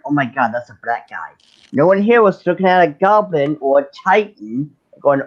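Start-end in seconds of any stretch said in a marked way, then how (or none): 0.1-1.3 lower, softer, fast mock gasp. Mocking imagined fans with a lowered, quick mock gasp.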